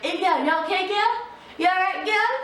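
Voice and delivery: a kind stranger asking if she's hurt; old man checking on her. Retold or imitated. Old man asks fallen girl if okay, concerned high voice. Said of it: "Only one old man, like".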